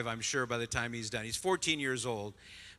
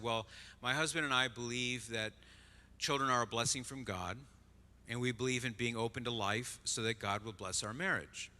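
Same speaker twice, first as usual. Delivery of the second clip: very calm; 2 semitones lower, 5 dB quieter than usual. Retold or imitated. Wife answering the negative waitresses, very calm. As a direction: say it simply and quietly, composed. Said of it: "she said in a very calm voice".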